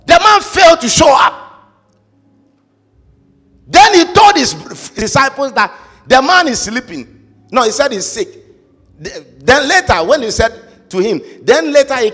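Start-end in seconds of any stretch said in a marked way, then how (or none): 0.1-6.5 loud, high, rousing preacher cry; shouting from the pulpit; full-force preaching. Acted. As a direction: preach it out to the whole room.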